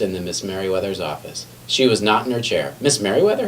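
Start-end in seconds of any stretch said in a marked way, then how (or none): 2.8-3.5 higher questioning call; puzzled asking. A narrator's reading. McBee finding the office empty, higher puzzled question. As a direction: look around the empty office and ask. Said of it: "he asked".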